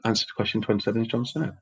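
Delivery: a hurried, muted repeat with a lift on Snow. Shown as a demonstration. Host rereading the answer after being corrected, quick and quiet. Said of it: "No, it wasn't".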